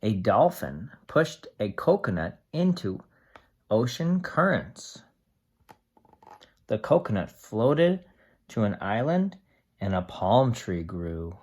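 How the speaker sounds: steady storybook read-aloud for a class; reading to children. Acted. Teacher reading a tree book to the class, steady storybook voice.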